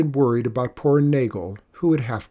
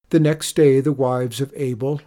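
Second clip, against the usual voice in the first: ordinary delivery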